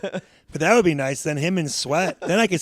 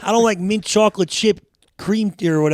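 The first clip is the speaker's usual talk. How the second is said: louder rant; 5 semitones higher, 5 dB louder than usual. Real, not acted. Ranting about mint ice cream.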